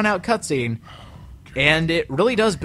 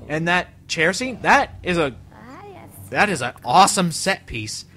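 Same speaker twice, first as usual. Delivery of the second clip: ordinary talk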